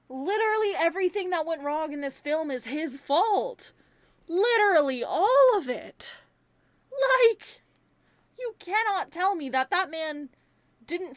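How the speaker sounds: exasperated, building to a high, loud outburst; indignant rant. Real, not acted. Reviewer blaming Mike for everything, indignant and building. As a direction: hammer the blame home, climbing in pitch and volume. Ranting at Mike's faults in film.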